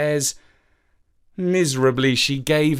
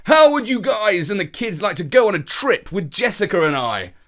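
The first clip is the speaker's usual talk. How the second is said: loud, higher excited uncle; 7 semitones higher, 4 dB louder than usual. A narrator's reading. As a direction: boom it out eagerly. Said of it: "he asked excitedly".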